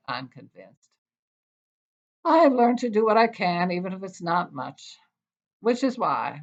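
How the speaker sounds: measured, expressive poetry reading voice; wry, deliberate poem recital. Acted. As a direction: read it like a poet at a reading. Reads her poem aloud.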